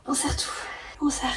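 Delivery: strained whisper. Real, not acted. Straining through a painful split.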